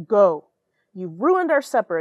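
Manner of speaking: loud, stern father's order; hammed-up angry father. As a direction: bark it like a fed-up dad. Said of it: "Her father said".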